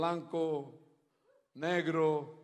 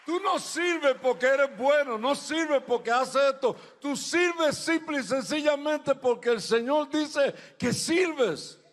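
high, loud, emphatic preacher; preaching at full force; 9 semitones higher, 11 dB louder than usual. Real, not acted. Preacher assuring listeners God says they serve, full-force preaching. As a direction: drive each phrase home loudly, high-pitched.

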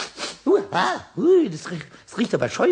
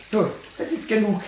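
plain reading voice

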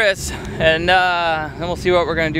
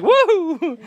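excited woo; 12 semitones higher than usual. Retold or imitated. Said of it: "she was like".

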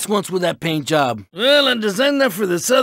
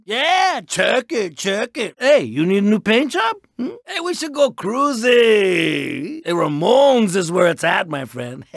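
ordinary talk, nothing marked

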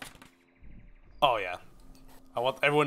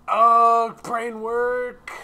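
effortful thinking; 10 semitones higher, 10 dB louder, much slower than usual. Real, not acted. Struggling to think of design.

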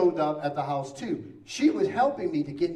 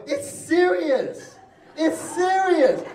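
high, slow, laughing plea; drawn-out plea; 12 semitones higher, 5 dB louder, much slower than usual. Retold or imitated. Patient pleading with the doctor, high slow plea. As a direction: stretch it out, pleading.

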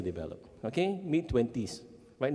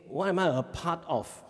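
normal talking voice

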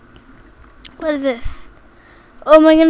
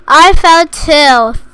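ordinary delivery

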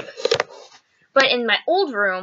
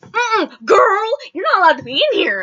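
high, louder sassy scolding; sassy bald dude; 9 semitones higher, 5 dB louder than usual. Retold or imitated. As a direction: wag a finger at the kids, mock sassy. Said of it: "came in and said".

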